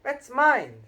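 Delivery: high, loud protest; snatch it back, loud and high; indignant boy. A narrator's reading. Harry protests to Uncle Vernon who grabbed his letter, loud. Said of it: "said Harry, trying to snatch".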